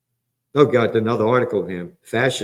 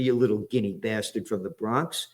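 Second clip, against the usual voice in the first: ordinary talk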